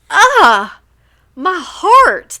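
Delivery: high, loud overwhelmed gush. Real, not acted. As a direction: swoon out loud over something sweet. Gushing over a sweet teacher story.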